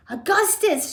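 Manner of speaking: high-pitched motherly shout. A narrator's reading. Mother shouts at son lapping chocolate, high shout. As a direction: call sharply to your son. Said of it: "shouted Missus Gloop".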